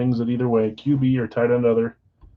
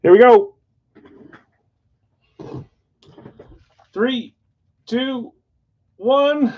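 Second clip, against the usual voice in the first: building, higher and louder each number; excited countdown; 12 semitones higher, 6 dB louder, slower than usual. Real, not acted. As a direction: hype up the reveal count. Countdown before opening pack.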